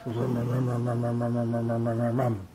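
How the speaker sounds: low, soft engine rumble; toy car engine. Shown as a demonstration. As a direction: hum a steady, low vroom for a toy car. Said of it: "Like this".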